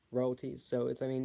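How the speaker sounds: usual speaking voice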